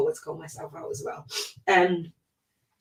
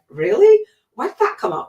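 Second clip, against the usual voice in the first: shocked at your own reaction, voice shooting high; stunned squeal of disbelief; surprised disbelief; 8 dB louder than usual. Retold or imitated. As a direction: pitch leaps way up, incredulous and louder. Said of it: "I was like".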